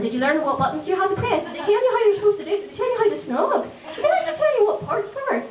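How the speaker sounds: giggling mock grilling; grilling mum.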